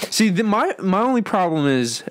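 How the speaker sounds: usual speaking voice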